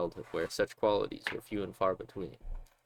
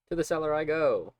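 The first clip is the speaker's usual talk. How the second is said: loud, high heroic call; loud proclamation; 7 semitones higher, 8 dB louder than usual. Real, not acted. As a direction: announce it like a hero.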